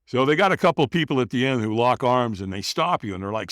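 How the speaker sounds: ordinary delivery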